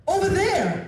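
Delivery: high, loud bark of "over there"; mock shout. Government worker shouting directions at him, a mock shout. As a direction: snap it out impatiently. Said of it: "she just says".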